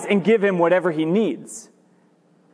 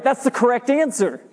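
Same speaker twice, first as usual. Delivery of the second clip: ordinary delivery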